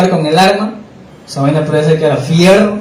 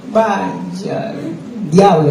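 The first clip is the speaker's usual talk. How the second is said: retold exclamation; 3 semitones higher, much slower than usual. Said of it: "yo dije así".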